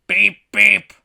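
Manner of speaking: high, very loud horn imitation; Bus God, booming. Giant celestial bus announces itself, booming. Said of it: "Booms the giant celestial bus".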